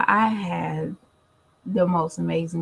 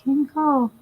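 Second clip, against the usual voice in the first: mock caller; 8 semitones higher, 4 dB louder than usual.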